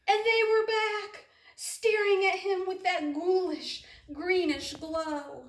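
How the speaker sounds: high, loud, then slowing into eerie; spooky reveal. A narrator's reading. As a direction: shock them, then creep.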